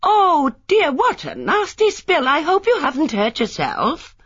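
a neighbour loudly fussing over Miss Marple; loud and friendly. Acted. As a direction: gush over a fallen old lady. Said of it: "Her voice was loud and friendly".

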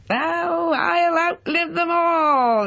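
very high, slow cackling crow; high cracked old man's gloat. Acted. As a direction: crow in triumph like a gleeful old man. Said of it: "he crowed".

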